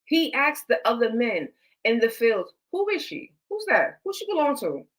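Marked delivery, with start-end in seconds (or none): 2.7-4.8 curious asking. Retold or imitated. Boaz asking men about Ruth.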